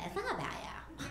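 mocking sing-song scold. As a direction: sneer it back at them. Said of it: "you know what they say".

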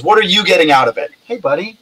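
ordinary delivery

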